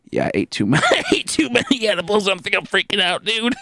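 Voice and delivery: laughing nervously through the admission; sheepish confession. Retold or imitated. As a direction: own up with a guilty giggle.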